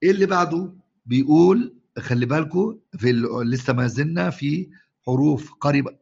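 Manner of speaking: ordinary talk, nothing marked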